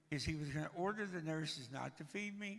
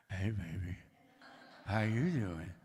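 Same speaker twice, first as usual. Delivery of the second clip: much lower, heavy-breathing Vader come-on; Darth Vader voice; 9 semitones lower, 4 dB louder than usual. Retold or imitated. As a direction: purr it low like a Darth Vader lover. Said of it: "I sound a little like Darth Vader".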